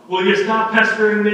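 loud, high snap of a fed-up mother; exasperated mom yell. Retold or imitated. Mother shouting at her pestering child in the store, exasperated yell. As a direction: yell it like a mom worn down by nagging. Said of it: "that's what she would say".

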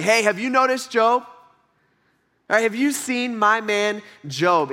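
lightly voiced proud God showing off his man; God boasting about Job. God pointing Job out to Satan, lightly voiced proud boast. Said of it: "He's like".

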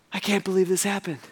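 soft, subdued disbelief; acted hushed dismay; stunned grief. Followers taking in his death, stunned and quiet. Followers mourn after he dies.

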